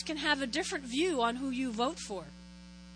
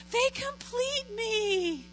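slow, higher swooning voice; mock dreamy; 7 semitones higher, much slower than usual. Mocking what young brides believe, a slow dreamy swoon. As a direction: swoon over the idea like a starry-eyed bride.

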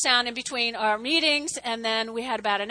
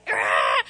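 low growl of exasperation; erupting growl. Retold or imitated. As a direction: let out a guttural angry roar. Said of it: "And I erupted".

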